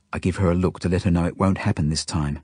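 ordinary delivery

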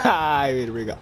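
chuckling apology; laughing. Real, not acted.

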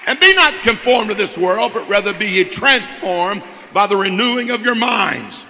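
building from firm to loud, driving pulpit preaching; preaching at full force. Real, not acted. Pastor pressing the church toward the altar, loud preaching. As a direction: preach it to the congregation, rising to a loud climax. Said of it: "I feel like preaching here a second".

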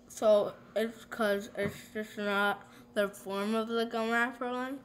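talking with fingers on teeth; picking at teeth while talking; fingers in mouth. Real, not acted. Seen 1: Foil seen only before; fingers at teeth during the words.

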